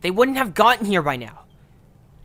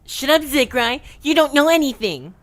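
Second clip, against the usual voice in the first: higher, hammed-up imitation of a sulky kid; mocking whine; 9 semitones higher, 4 dB louder than usual. Zekrai mocking what Seituo would usually say, bratty and higher. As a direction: parrot her back in a bratty higher voice.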